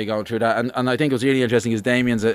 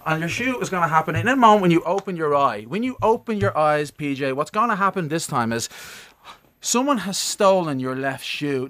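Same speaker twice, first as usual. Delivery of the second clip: hypnotic suggestion; 4 semitones higher, slower than usual. Real, not acted.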